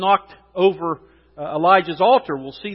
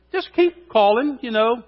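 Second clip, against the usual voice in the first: lightly mocking, higher voice; 6 semitones higher than usual. Elijah mocking their false god, mocking. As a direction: needle them with mock encouragement. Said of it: "Elijah is mocking their false god".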